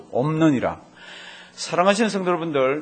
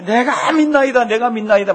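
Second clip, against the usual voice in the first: loud, much higher retold plea; mock wailing shout; 7 semitones higher, 6 dB louder than usual. Father wailing to Jesus, loud higher retold plea. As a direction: cry it out like a desperate father. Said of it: "고함을 지르면서".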